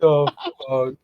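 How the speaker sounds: ordinary talk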